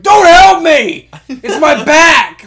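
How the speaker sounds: high, slow, loud acted-out protest; mock pained screaming. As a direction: yell like an injured man refusing help. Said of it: "like, screaming".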